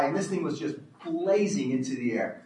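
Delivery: usual speaking voice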